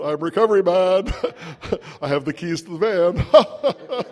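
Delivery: smug mock swagger. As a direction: puff up like a know-it-all newcomer, laughing at himself. Mocking his cocky younger self.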